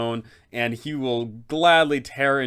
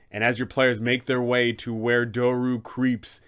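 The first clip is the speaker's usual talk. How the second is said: normal talking voice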